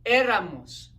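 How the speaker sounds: Éramos with stress on the first syllable É, said loud; accented Éramos. Shown as a demonstration. Teacher modeling Éramos for the class to repeat, stressed É. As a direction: hit the É of Éramos hard. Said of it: "Notice the accent".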